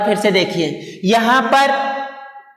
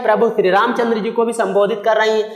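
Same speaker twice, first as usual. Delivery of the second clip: ordinary delivery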